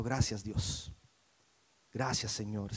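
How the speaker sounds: low, very soft prayer of thanks; imitating a subdued private prayer; hushed prayer. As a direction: voice a quiet, humble thank-you to God. Voicing Jesus praying when betrayed.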